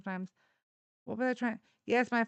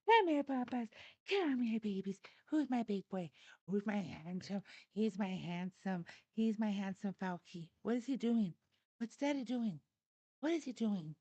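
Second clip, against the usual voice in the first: doggy talk; 5 dB quieter than usual. Real, not acted. Baby talk to the dog.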